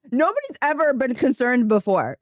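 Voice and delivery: high, laughing through the words; can't stop giggling at the absurdity. Real, not acted. Laughing to chat about her husband's remark.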